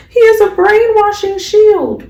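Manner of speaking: high, mocking imitation of the older sister; mock villain voice. Retold accusation by Shorty Doo-Wop.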